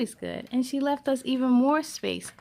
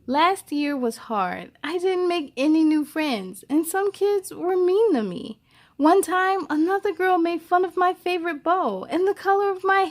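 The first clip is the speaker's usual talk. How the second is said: put-on louder, lively storybook reading for kids; reading to children; 2 semitones higher, 7 dB louder than usual. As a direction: read Esperanza's words out to children. Reads the book aloud.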